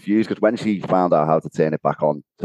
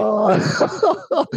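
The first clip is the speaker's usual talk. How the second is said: laughing oh; 14 semitones higher than usual. Real, not acted. Laughing at the story.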